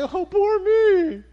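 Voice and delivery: loud, high drawn-out mock wail; hammed-up woe-is-me cry; mock self-pity. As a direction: whine it mockingly, stretching the words. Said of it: "Why are you going".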